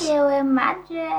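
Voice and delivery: slow, stretched echo; playful echo. Pretend. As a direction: draw out the phrase teasingly. Echoing phrase playfully.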